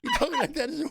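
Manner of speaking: usual speaking voice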